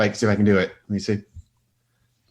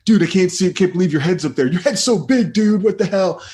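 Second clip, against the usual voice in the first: higher, louder teasing shout; mock excited friend; 10 semitones higher, 4 dB louder than usual. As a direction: ham up a friend's disbelief. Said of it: "look what I saw today".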